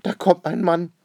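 high, loud alarmed call; crying out. A narrator's reading. Lady sees a man arriving, crying out. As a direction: announce it in a high, loud flutter. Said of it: "ruft die Dame".

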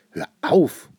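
put-on dad telling the child to quit the nonsense, loudly; called out. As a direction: cut the child off with a shout. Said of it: "ruft Papa".

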